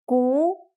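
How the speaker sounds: 咕 in the rising second tone. Shown as a demonstration. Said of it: "Let's try some more".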